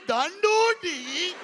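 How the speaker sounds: put-on high, loud, slow Indian-accented reply; mock Indian accent. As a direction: draw it out in an exaggerated Indian accent. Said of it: "the funniest accent is the Indian accent".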